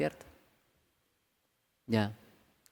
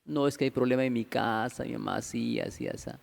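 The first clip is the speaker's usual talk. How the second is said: imitated tearful complaint, sinking low; llorando; 4 semitones lower, 6 dB quieter than usual. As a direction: mimic a weepy volunteer, trailing off softly. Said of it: "habla usted que está llorando". (quejido lloroso imitado, cada vez más bajo)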